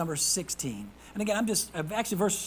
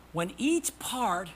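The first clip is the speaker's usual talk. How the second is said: ordinary talk, nothing marked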